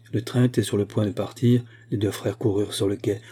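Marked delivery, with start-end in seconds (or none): none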